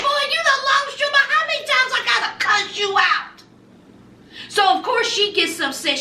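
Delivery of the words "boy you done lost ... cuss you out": sassy Lisa. Retold or imitated. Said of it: "Lisa said".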